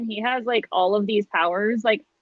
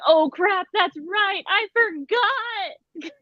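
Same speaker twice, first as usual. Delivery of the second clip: higher, dragging out the final I forgot; oh-no moment, pitched up and slowing; sudden realization; 8 semitones higher, much slower than usual. Retold or imitated. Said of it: "I was like".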